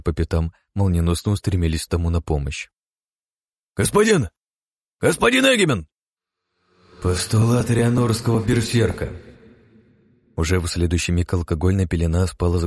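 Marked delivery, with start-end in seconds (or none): 3.8-5.9 very high, loud alarmed cry; alarmed shout. Acted. Guards rush to fallen charge. 7.0-9.1 spell incantation. Acted. Casting a spell.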